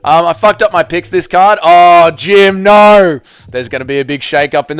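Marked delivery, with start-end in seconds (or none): 1.6-3.2 loud, high groan of dismay; loud real despair; dismayed shout. Real, not acted. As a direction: cry out at the bad news. Dismayed outburst at lost picks.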